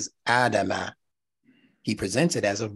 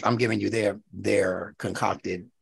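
ordinary delivery

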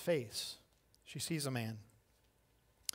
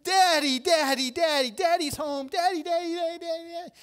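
put-on very high, loud child squeals; excited little girls; 14 semitones higher, 9 dB louder than usual. Little daughters run to dad across parking lot, excited shrieking. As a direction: imitate kids shrieking for their dad. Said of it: "those three girls come running".

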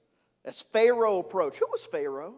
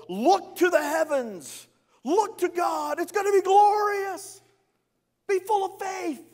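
high, rousing call; confident Moses; 10 semitones higher than usual. Retold or imitated. Moses outwardly rousing the people. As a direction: lift the people's eyes upward.

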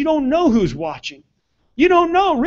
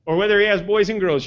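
usual speaking voice

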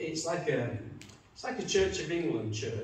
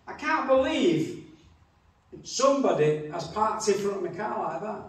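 agitated complaint; 5 semitones higher, 4 dB louder than usual. Retold or imitated.

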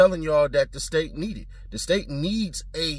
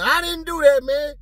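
mock yell; 11 semitones higher, 6 dB louder than usual. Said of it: "on the phone yelling".

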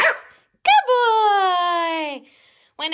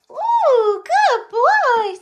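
excited praise; 8 semitones higher, 7 dB louder, much slower than usual. Real, not acted. Praising dog after fetch.

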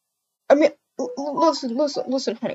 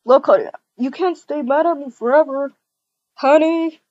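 louder calling through a door; 4 dB louder than usual. Acted. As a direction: call to her inside. Boyfriend calls through door.

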